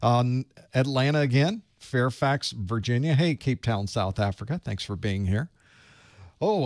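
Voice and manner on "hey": usual speaking voice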